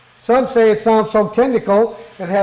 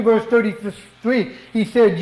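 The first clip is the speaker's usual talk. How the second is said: ordinary delivery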